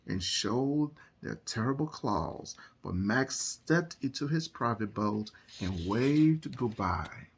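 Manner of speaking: lively storytime narration over music; picture book reading. A narrator's reading. Reading a picture book to listening children, animated storytelling. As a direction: read it like bedtime storytime.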